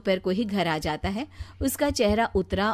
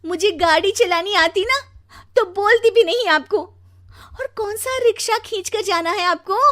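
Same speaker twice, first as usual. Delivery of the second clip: high, loud scolding wife; झल्लाई पत्नी; 11 semitones higher, 7 dB louder than usual. Acted. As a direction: snap at your husband in a raised, high voice. (झल्लाकर ऊँची आवाज़ में ताना)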